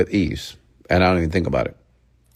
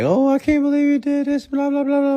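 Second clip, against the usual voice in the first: much higher mocking falsetto; mock whining woman. Imitating the crying girlfriend, mocking high falsetto. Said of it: "and be like".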